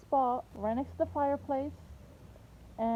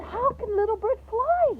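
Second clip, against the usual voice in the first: put-on puzzled little girl; 8 semitones higher, 4 dB louder than usual.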